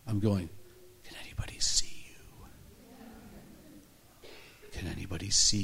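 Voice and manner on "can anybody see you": slow, drawn-out whisper; hushed, stretched-out whispered question; secretive whisper. Retold or imitated. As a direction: whisper it so nobody around overhears. Asks a vision secretly on airplane.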